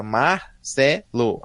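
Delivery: "Marcelo" slow, broken into Mar, Cé, Lo; stitched-together syllables. Retold or imitated. Host mimicking the synthesizer joining files, "Marcelo" in disconnected syllables. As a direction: say "Marcelo" as three separate recorded pieces. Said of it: "juntava na memória o mar com Cé com Lô".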